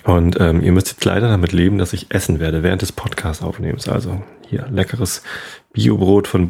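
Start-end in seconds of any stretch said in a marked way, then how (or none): none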